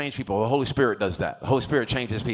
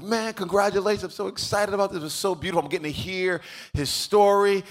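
higher-pitched enthusiastic congratulating; upbeat, raised-pitch praise; retold congratulations; 6 semitones higher than usual. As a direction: re-enact being delighted for someone. Retold congratulations to gym guy.

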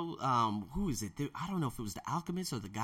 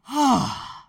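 loud high sigh; 10 semitones higher, 12 dB louder than usual. Retold or imitated. After freaking out.

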